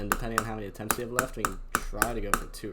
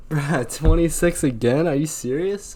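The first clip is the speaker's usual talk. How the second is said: loud, higher, slow outburst; frustrated shout; 6 semitones higher, 8 dB louder than usual. Real, not acted. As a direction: shout at the screen. Dies again, frustrated.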